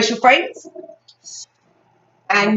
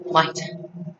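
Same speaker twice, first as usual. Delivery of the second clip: soft, voiceless aside; hushed cue; 4 semitones lower, 8 dB quieter than usual. Shown as a demonstration. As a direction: say it under your breath. Teacher's quiet cue for own turn.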